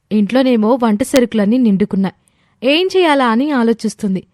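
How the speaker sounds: ordinary delivery, nothing marked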